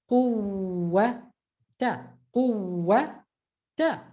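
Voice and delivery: Quwwata with a long held ww; emphasized waw. Shown as a demonstration. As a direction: hold the uww in Quwwata before -ata. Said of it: "we're just emphasizing the waw".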